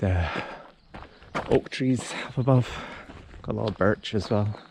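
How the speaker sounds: ordinary talk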